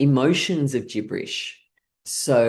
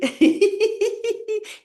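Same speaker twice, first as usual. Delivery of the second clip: bright giggle; real high-pitched laugh; giggling. Real, not acted. Laughing at her gibberish.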